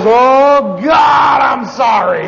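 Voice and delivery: high, drawn-out shout of remorse; loud repentant cry. Retold or imitated. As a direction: wail it out so the whole cabin hears. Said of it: "they all heard his repentance".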